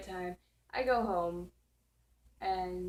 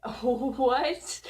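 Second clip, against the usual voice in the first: higher, louder laughing "what"; laughing confusion; 6 semitones higher, 8 dB louder than usual. Retold or imitated.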